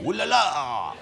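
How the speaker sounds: Pascal Sauvage. Retold or imitated. Host mimics the film's French villain, comedy French accent. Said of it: "the full-on kind of comedy cinematic".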